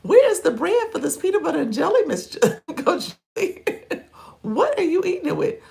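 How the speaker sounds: teasing disbelief; bright mock outrage, rushed; playful incredulous question. Real, not acted. Teasing question to Coach Lee.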